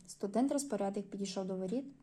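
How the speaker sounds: usual speaking voice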